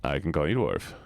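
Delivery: low, drowsy insistence; sleepy voice. Shown as a demonstration. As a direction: drop the pitch right down as if half asleep. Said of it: "I know you love sleepy voice".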